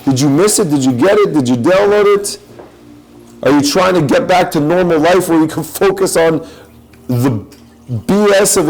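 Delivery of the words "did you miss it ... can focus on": ordinary talk, nothing marked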